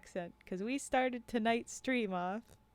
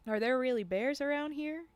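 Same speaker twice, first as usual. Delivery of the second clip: raised southern voice, rising question; southern accent; 5 semitones higher, 4 dB louder than usual. Acted. Hunter Cal asking guides about bears, southern accent. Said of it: "now I'm doing a southern accent".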